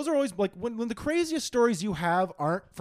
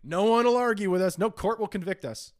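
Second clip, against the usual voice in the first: loud, raised proclamation; mock villainous authority; 4 semitones higher, 6 dB louder than usual. Campus security plotting against students, grandly untouchable. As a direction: declare it like a power-drunk guard.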